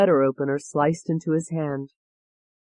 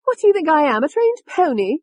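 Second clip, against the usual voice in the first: higher, louder, scornful London voice; Tony's voice; 11 semitones higher, 5 dB louder than usual. A narrator's reading. Tony mocking the people who ordered her about, scornful. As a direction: sneer it out as the brash alter ego. Tony snapping at Ashley's captors.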